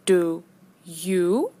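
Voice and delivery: ordinary talk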